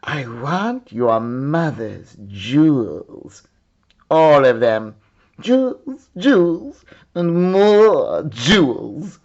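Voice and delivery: greedy, grinning demand, slow at the start; greedy cook naming her price for the princess. Acted. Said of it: "with a greedy grin".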